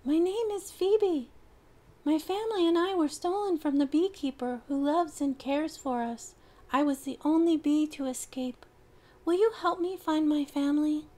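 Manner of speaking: high, tiny pleading bee; a lost little bee asking for help; small bee voice. Acted. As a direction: Phoebe the bee telling her story. Bee character voice.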